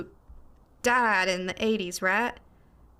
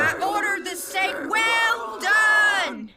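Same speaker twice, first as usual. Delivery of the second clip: high, slow sing-song shout; a wailing attempt at terror; scary ghost voice; 10 semitones higher, 4 dB louder, slower than usual. Shown as a demonstration. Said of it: "I'm not getting it right".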